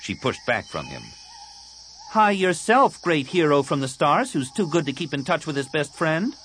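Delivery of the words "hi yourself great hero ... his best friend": high, loud scolding of a friend; high-pitched girl, piqued and sarcastic; indignant Tahiri. Acted. Tahiri scolds her best friend for losing touch, indignant.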